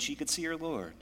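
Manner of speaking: ordinary talk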